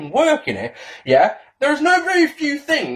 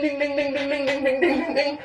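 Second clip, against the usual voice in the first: bell imitation; 6 semitones higher, 5 dB quieter than usual. Imitating a bell before confession.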